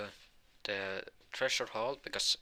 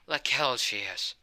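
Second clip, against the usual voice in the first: loud, slow, defiant denial; acted angry retort; 3 semitones higher, 7 dB louder, slower than usual. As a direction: throw it back hard and drawn out. Asta rejects rival's claim to girlfriend.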